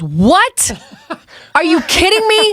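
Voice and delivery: imitating a shocked caller, pitch shooting way up; a high, squeaky are you kidding me; mock shock, voice jumping high on the question; stunned disbelief. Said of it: "so Miguel goes".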